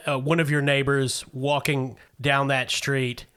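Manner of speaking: ordinary talk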